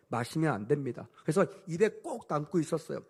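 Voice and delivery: ordinary delivery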